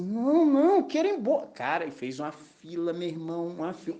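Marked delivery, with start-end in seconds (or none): 0.0-1.5 high, whiny mock plea; whiny inner protest. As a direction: whine like a kid who wants to leave. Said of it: "Eu falei assim".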